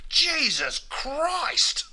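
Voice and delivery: very slow, high-pitched oath; long drawn-out astonished cry; surprised or angry exclamation. Acted. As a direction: stretch it out in high disbelief. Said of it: "when they're surprised or angry".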